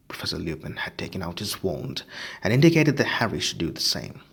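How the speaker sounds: ordinary delivery, nothing marked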